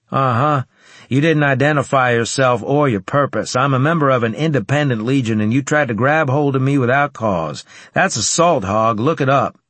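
confident soldier. Acted. McGill lecturing the guard.

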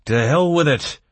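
loud, high reckless cry. A narrator's reading. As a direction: shout it out like a battle cry. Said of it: "we cried out in unison".

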